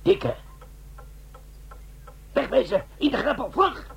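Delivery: high, urgent shouted orders to run; panicked alarm. Acted. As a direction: get everyone into the ditch now. Bomb is ticking, flee. (paniekerig en hoog geroepen)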